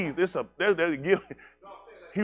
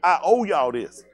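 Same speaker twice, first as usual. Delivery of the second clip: loud mock reply; 9 dB louder than usual. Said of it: "I'm like".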